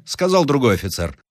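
plain reading voice

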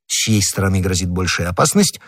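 usual speaking voice

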